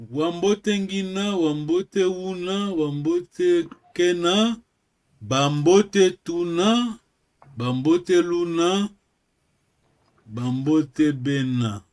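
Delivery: slow, measured list of greeting forms; slow recital. Shown as a demonstration. Teacher reciting greeting forms to the class, slow recital. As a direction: recite each form slowly, drawing it out.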